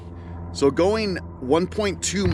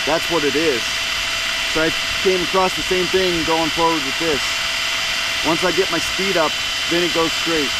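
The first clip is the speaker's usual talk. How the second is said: louder, projecting over motor noise; raised voice; 4 semitones higher, 5 dB louder than usual. Real, not acted. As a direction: speak up over a running motor. Talking over the running motor.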